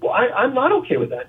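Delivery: wife objecting in a mild mock yell; mock upset yell. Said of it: "She started yelling".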